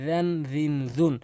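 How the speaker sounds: রেন, রিন, জুন opening with voiced th; tongue tip on teeth edge for রেন, রিন, জুন; dhal from tongue tip. Shown as a demonstration. Teacher showing the articulation point, voiced th in রেন, রিন, জুন. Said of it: "জিহ্বার আগা এবং দাঁতের আগা থেকে".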